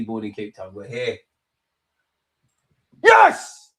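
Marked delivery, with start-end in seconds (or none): 3.0-3.7 very high, loud cheer; triumphant yell. Real, not acted. Fan celebrating as Apolis has scored, yelling. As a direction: let out a piercing victory cry.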